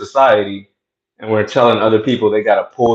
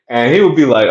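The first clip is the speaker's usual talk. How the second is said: ordinary talk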